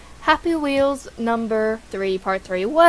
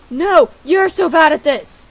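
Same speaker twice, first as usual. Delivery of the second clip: louder comeback, punching YOU'RE; dad's retort; 3 semitones higher, 7 dB louder than usual. Acted. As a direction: throw it back at the son, loudly. Dad answers son back in kind.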